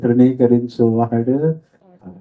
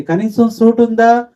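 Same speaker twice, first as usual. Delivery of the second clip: scornful question; 10 semitones higher, 4 dB louder than usual. Retold or imitated. Church people scorn poor man.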